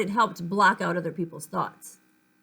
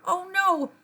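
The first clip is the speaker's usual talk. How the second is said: alarmed; 9 semitones higher, 4 dB louder than usual. Acted. Feet walk toward the door.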